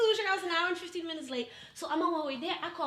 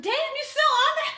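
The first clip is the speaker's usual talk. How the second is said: high, loud retold disbelief; incredulous exasperation; 12 semitones higher, 9 dB louder than usual. Answering her date's lingering grudge, incredulous exasperation. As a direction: throw it up high, mock incredulous.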